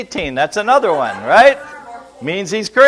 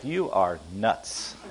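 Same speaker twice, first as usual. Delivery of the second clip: mock quiet puzzled; 10 semitones lower, 13 dB quieter, much slower than usual. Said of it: "looking at me like".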